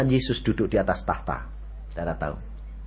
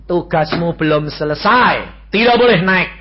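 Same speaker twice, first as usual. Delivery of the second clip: firm command; 6 semitones higher, 9 dB louder than usual. Retold or imitated. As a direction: lay down the law. Jesus insists.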